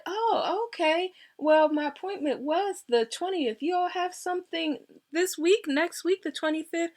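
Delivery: high-pitched, questioning caller retelling; puzzled caller. Retold or imitated. Patient to scheduler about the twenty-fifth, puzzled and high.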